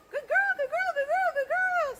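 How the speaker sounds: high, loud, excited dog praise; squeaky praising a dog. Real, not acted. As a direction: gush over the dog in a high voice.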